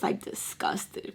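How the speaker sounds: hushed, slow disgust. Retold or imitated. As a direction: let it out slowly under the breath. Describes her look of disgust.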